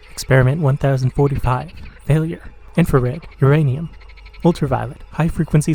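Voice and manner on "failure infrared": plain reading voice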